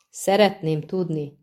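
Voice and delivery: ordinary delivery, nothing marked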